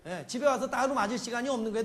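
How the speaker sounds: usual speaking voice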